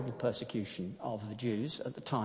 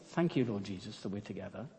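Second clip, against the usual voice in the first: quiet prayer; 5 semitones lower, 7 dB quieter, faster than usual. Acted. Corrie's reluctant quiet prayer.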